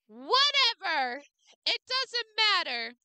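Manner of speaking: slow, high, loud whatever; mock dismissive. Real, not acted. Giving up on saying the name, mock shrug. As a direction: toss it off with a shrug.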